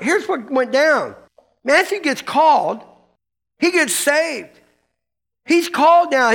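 ordinary delivery

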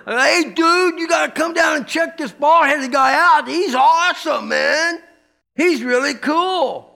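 high, loud excited dude call; hippie dude. Retold or imitated. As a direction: hype up a friend to come hear the teacher. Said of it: "I remember".

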